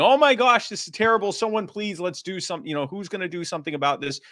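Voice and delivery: mock-alarmed plea for someone to act; mock panic. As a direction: ham up the helpless bystander. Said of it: "what most people do and going".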